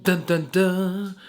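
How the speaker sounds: loud, slow "dun, dun, dun"; mock dramatic sting. Vlogger hyping up the incident, mock dramatic sting. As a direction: voice a suspense-film sting, loud and drawn out.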